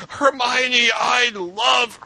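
high, strained dying cry, cut off mid-line; dramatic dying hero. Retold or imitated. Retelling the fanfic to co-hosts, a hammed-up dying cry. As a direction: play the fallen hero's last gasp, hammed up. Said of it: "This is very dramatic".

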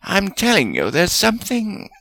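high-pitched, insistent snap; snapping. A narrator's reading. Wizard insisting the girl is special, snapping. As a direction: insist urgently, pitch well up. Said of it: "Salazar snapped".

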